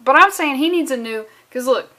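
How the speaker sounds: ordinary talk, nothing marked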